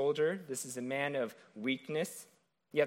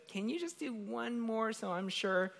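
whiny doubter; 8 semitones higher, 5 dB quieter than usual. Retold or imitated. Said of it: "So he says".

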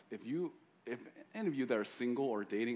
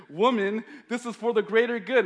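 much higher, loud exasperated yell; frustrated shout; 11 semitones higher, 12 dB louder than usual. Retold or imitated. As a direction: lose patience and bark it out. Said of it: "he gets frustrated".

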